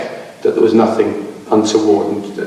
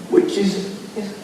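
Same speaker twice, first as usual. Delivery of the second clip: straining a hoarse, failing voice; 9 semitones higher, 8 dB quieter than usual. Real, not acted. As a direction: voice giving out, hoarse. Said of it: "losing my voice".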